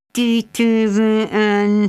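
slow, strained hiss; hissed through teeth. A narrator's reading. Bridget repeats it secretly to Sharon, hissing slowly. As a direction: squeeze it out through clenched teeth. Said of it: "sziszegve a fogaim között".

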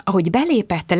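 plain reading voice

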